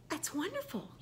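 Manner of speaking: soft whispered aside, no voice; hushed little "that's wonderful"; whispered praise. Real, not acted. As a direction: breathe it out as a private compliment.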